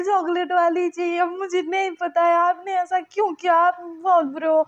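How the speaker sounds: high, loud childish tantrum, mock angry. Lanson yelling at Suyang over his pastry, mock tantrum. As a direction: stamp your foot like a spoilt child, loudly. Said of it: "गुस्से में सुयंग को बोलता है".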